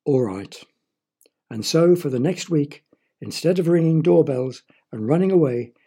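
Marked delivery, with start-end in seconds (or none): 0.0-0.6 grudging groan. Acted. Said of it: "she had to groan".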